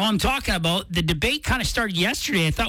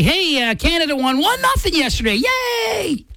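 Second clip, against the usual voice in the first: higher-pitched, mock-cheerful radio chucklehead; clueless host cheer, ending on a high "Yay!"; 7 semitones higher than usual. Imitating a radio host's scoreline for soccer fans, chucklehead cheer. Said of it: "chucklehead on the radio say".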